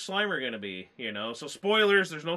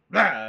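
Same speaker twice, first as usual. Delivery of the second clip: sudden loud roar; mock jump-scare roar; 10 dB louder than usual. Dog jumping out of the shadows, sudden loud mock roar. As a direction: burst out like something leaping from the dark.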